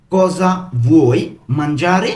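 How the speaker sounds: slow "Cosa ... vuoi ... mangiare?", each word distinct; broken into pieces. Shown as a demonstration. Teacher putting the question together for learners, word by word. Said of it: "আমি কিন্তু ভেঙে ভেঙে বলছি".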